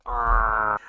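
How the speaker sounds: loud frustrated growl of regret; frustrated groan. Real, not acted. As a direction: let out a real groan at your mistake. Regrets wrong pick.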